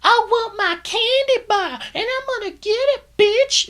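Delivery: put-on high, loud shrieking girl voice; mock shrieking yell. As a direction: screech it like an annoying villain. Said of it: "constantly is yelling".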